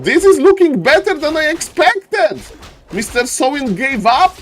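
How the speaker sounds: hyped shoutcaster call; excited caster. Real, not acted. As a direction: burst out with delight at the game. Caster hype moment.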